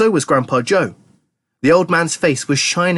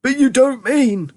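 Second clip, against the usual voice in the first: high, drawn-out disbelief; aghast high-pitched cry; alarmed shout; 12 semitones higher, slower than usual. A narrator's reading. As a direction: protest slowly in high alarm. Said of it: "shouted Grandpa Joe".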